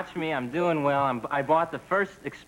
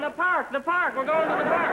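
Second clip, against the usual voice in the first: excited dog; 10 semitones higher than usual. Pretend. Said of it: "The dog's going".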